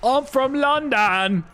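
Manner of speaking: high, slow, exaggerated London accent; mock London accent. Streamer testing his dodgy English accent, high mock London voice. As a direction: stretch out a hammed-up London accent. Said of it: "I am doing that, aren't I?".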